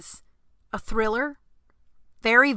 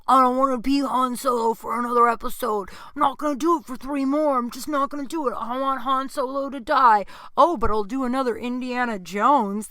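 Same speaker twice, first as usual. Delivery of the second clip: higher, louder whiny imitation; mock petulant refusal; 4 semitones higher, 5 dB louder than usual. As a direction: mimic a star refusing like a sulky kid. Mocking actor's refusal to George Lucas.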